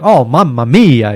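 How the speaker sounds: loud, slow Italian mamma mia; booming sing song welcome. Retold or imitated. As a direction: savour it like a delighted Italian. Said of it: "And you eat it, like".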